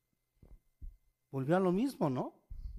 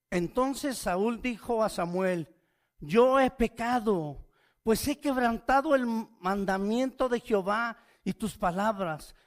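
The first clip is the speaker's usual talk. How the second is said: higher, louder solemn reading; scripture reading; 6 semitones higher, 6 dB louder than usual. Acted. Preacher reads Saul's confession to Samuel, solemn and loud.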